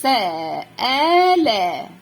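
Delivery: سآلة stretched very slowly so the middle hamza rings out; drawn-out demo word. Shown as a demonstration. Teacher showing pupils the middle hamza, very slowly stretched. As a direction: hold every sound of سآلة long and loud. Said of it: "زي كلمة".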